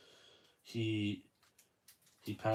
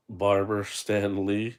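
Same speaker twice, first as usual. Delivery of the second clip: Barber Stan Lee drawn out slowly and louder; slow read-out; 9 dB louder, much slower than usual. Shown as a demonstration. Reading the figure's nameplate to viewers, slow and loud. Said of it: "it says on the plate right there".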